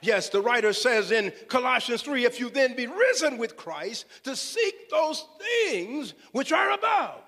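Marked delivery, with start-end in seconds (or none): none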